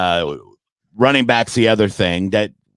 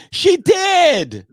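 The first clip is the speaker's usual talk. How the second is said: much higher, louder shouted "She did"; triumphant shout; 3 dB louder than usual. Real, not acted. Mock triumph over a heckler.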